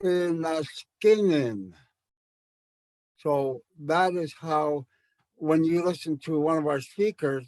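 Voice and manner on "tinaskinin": Tinaskinin said slowly, tone up on ski; slow rising second-last syllable. Shown as a demonstration. Teacher slowly showing the tone on Tinaskinin. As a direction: draw Tinaskinin out, lifting ski. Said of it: "goes up in tone".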